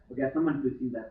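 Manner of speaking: ordinary delivery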